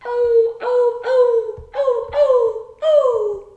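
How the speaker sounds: high, loud howling oh's; long whining dog cries, loud and high; dog crying out. A narrator's reading. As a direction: wail like a sad old dog. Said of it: "he heard a cry".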